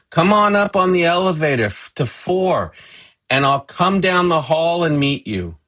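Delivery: high, loud, welcoming call; calling into intercom. Acted. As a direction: holler directions to visitors downstairs. Mother calls into the intercom.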